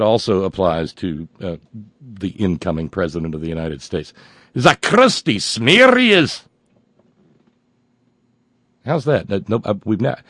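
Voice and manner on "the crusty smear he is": slow, high hammed-up Scottish "The crusty smear he is!"; overdone Scottish burr, stretched out; Scottish accent. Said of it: "I'm not proud of my Scottish accent".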